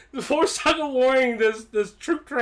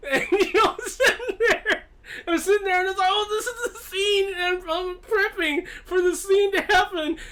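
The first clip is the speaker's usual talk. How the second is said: high-pitched, talking through the giggles; real helpless laughter; 9 semitones higher than usual. Real, not acted. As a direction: struggle to get the story out while cracking up. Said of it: "I'm laughing like I am now".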